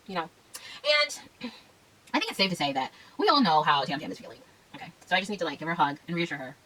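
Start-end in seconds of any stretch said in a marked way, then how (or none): none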